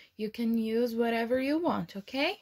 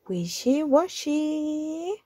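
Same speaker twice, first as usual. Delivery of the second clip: 'wishy-washy' drawn out slowly, a bit higher; slow refrain; 5 semitones higher than usual. Acted. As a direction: stretch 'wishy-washy' as the closing line. Closing refrain, slowed.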